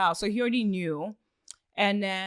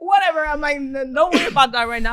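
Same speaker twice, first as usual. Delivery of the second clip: high, loud dismissive shout; shouted brush-off; 6 semitones higher, 9 dB louder than usual. Retold or imitated. Telling Tyrese never mind while hunting her dog, shouting. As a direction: wave it off with a shout.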